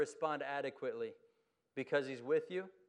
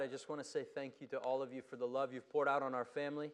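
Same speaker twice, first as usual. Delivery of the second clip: ordinary talk, nothing marked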